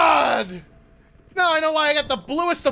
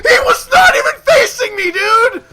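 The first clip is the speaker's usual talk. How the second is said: high, loud protest at an unfair play; outraged yell; 6 semitones higher, 5 dB louder than usual. Real, not acted. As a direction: yell it in real disbelief and fury. Furious outburst at the game.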